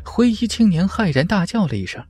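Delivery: ordinary delivery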